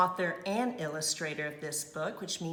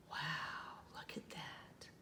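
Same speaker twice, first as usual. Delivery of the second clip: soft gasp then breathless whispered wow; be awed by the wall; hushed astonished whisper; amazed gasp; 16 dB quieter, slower than usual. Real, not acted. Reveal of the crumpled gallery.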